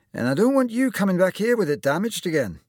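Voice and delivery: high, loud Scottish surgeon; good-natured warning in Scottish tones; Scottish accent. Acted. Surgeon sending the lad off, Scottish accent. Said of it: "with just a hint of a Scottish accent".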